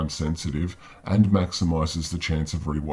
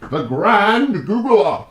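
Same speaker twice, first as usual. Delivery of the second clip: mock grand title; 9 dB louder, much slower than usual. Mock title for know-it-alls.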